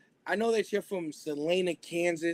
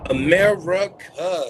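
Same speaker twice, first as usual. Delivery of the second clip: drawn-out shout; 9 dB louder, slower than usual. Acted. Radio host signature call.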